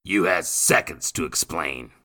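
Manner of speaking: snarl. Acted. Nathan to William, menacing snarl. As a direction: threaten with the knife. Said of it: "Nathan snarled".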